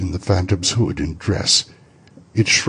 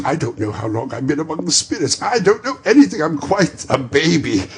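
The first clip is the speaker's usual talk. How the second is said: rising higher and louder with glee; a schoolboy's joy; giddy, merry outburst; giddy delight; 8 semitones higher, 5 dB louder than usual. Acted. Scrooge giddy.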